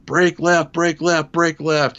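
high urgent shouted warning, repeated; yelling. Retold or imitated. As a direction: shout it at the pilot to turn now. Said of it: "I'm yelling".

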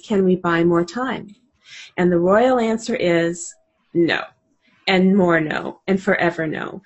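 measured, reflective book reading; reading aloud. Acted. Guest reads a page from the book aloud to listeners, reflective reading.

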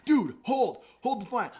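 loud, high eager call. Retold or imitated. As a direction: urge the guitarist to wait. Urgent offer to the guitarist.

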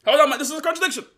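high, loud, fast mock outcry; indignant objector yelling from the pews; mock objector shout. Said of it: "some of you in here will go".

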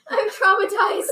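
high, giggly complaint; laughing protest. Real, not acted. Just thrown off the couch, laughing mock distress. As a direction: squeal it through laughter.

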